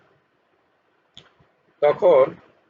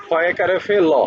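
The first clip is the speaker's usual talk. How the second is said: normal talking voice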